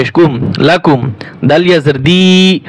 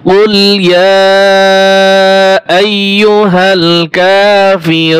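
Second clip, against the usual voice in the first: slow, melodious tajweed chant; Quran recitation; 4 semitones higher, much slower than usual. Shown as a demonstration. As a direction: recite the verse in long drawn tones. Teacher recites the verse melodiously. (ধীর সুরেলা তিলাওয়াত)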